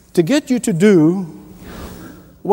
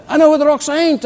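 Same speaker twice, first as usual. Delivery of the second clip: relishing punchline; 8 semitones higher, faster than usual. Real, not acted. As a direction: savour the line, lifting it.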